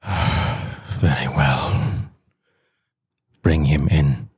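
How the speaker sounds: low, sighing surrender; reluctant consent. Acted. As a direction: give in slowly with a heavy sigh. Proconsul gives in reluctantly.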